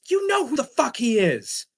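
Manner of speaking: high, loud scolding mom voice; scolding mother. Retold or imitated. Mother scolding her son Jimmy for fighting, high angry rant. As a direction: tear into him like a furious mother.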